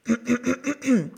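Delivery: theatrical repeated throat clear. A narrator's reading. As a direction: clear your throat before reciting. Fox prepares to recite poem. (gekünsteltes Räuspern vor dem Vortrag)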